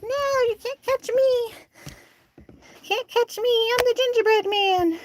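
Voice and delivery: high, slow teasing sing-song; hen's voice. Retold or imitated. Owner voicing hen Apple taunting her, high teasing. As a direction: voice the hen taunting like the gingerbread man. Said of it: "Apple was like".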